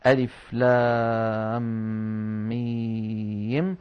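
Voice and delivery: very slow recitation, long-held vowels in لام and ميم; chanted letters, لام and ميم each greatly lengthened; prolonged madd. Shown as a demonstration. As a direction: stretch لام and ميم far out on a steady tone. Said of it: "يجب أن تمدها أيها القارئ".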